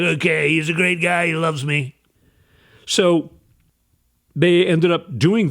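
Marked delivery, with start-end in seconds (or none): 0.0-2.0 Donald Trump. Acted. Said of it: "Donald Trump's like".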